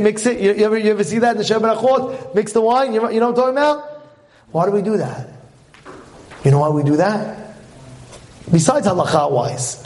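ordinary delivery

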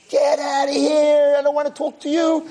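acted high-pitched grumpy snarl; grouchy rebuff. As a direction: shoo him off like a sour old man. Angry old man rebuffs roommate.

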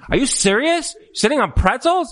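imitating a high scolding wife; shrill nagging. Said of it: "You know what he hears".

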